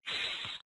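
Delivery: "th" breathed, no voice; unvoiced th. Shown as a demonstration. Listener's question quoted with the unvoiced sound. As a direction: hiss the "th" softly. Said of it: "unvoiced T H".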